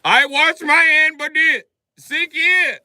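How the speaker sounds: high, loud, slow mock Indian accent; drawn-out excuse from the clerk. Clerk defends his handwashing, slow high mock accent.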